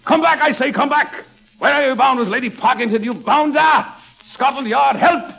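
high, hammed-up English indignation; incensed English father. Shown as a demonstration. Father shouting after eloping lovers, high mock-incensed English voice. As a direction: cry out high like an outraged English gentleman. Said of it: "you become incensed and you say".

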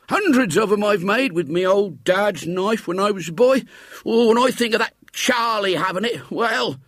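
put-on high, creaky old countryman voice; old man coaxing the boy, pitched high. A narrator's reading. Old man's voice in story.

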